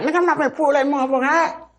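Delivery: very high, alarmed no way; refusing to pull the screwdriver from his friend's neck; horrified refusal. Retold or imitated. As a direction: recoil from the request.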